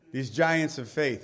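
ordinary talk

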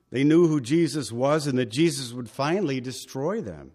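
normal talking voice

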